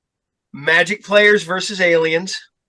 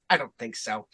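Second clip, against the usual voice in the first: much lower, softer dismissive line; calm monster girl; 7 semitones lower, 10 dB quieter than usual. Retold or imitated. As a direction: drop it low and quiet like an alien. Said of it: "is like".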